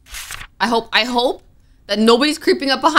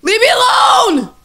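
high, loud, drawn-out shriek; real panicked yell; terrified scream; 14 semitones higher, 7 dB louder, slower than usual. Real, not acted. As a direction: scream to fend it off. Screaming at threat in game.

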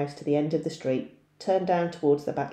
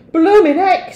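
loud, much higher gasp of surprise; startled exclamation; 15 dB louder than usual. Acted. Boy startled by the cracking garage, shouting in surprise. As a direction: blurt it out in shock.

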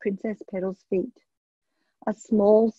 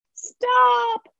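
shout. A narrator's reading. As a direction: yell to stop the dancing dragon. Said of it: "shouted Princess Petal above the din".

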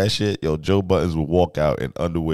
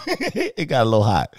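high-pitched, repeating it through a laugh; amused, laughter lifting the pitch; 13 semitones higher than usual. Real, not acted. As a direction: chuckle and say it higher. Cracks up at own remark.

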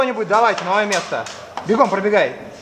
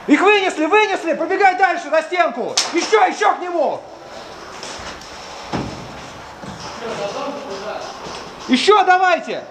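raised voice, pushing the team; urgent shouting; 7 semitones higher than usual. Real, not acted. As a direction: call them forward, urgent. Urging squad forward mid-fight.

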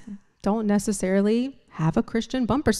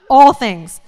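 "All things" shouted loud, falling; stress on all things; 3 semitones higher, 11 dB louder than usual. Real, not acted. Preacher to church, stressing "All things" loudly. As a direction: punch "All things" once more. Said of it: "I am so passionate about this".

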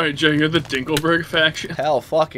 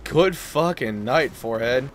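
ordinary talk

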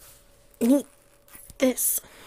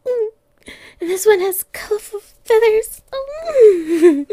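much higher and louder, eager squealing over toys; excited squeals; 8 semitones higher, 6 dB louder than usual. Acted. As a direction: bounce like a kitten who just saw toys. Catgirl thrilled by new toys.

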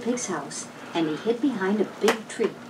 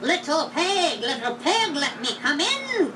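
lightly witchy voice, high and slow; a hint of a cackling witch; witchy wolf; 5 semitones higher, slower than usual. A narrator's reading. Wolf begs at the pig's door, lightly witchy and slow. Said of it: "a witch's voice for the wolf".

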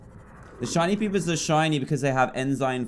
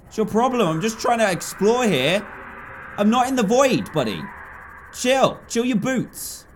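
much higher, louder exasperated yelling; 11 semitones higher, 6 dB louder than usual. Real, not acted. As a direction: shout at the pest to back off. Yelling at the chasing ghost.

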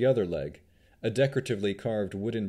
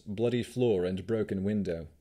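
ordinary delivery

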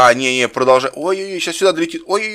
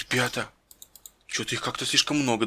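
hushed; 5 semitones lower, 11 dB quieter than usual. Pretend. Сиди тихо.

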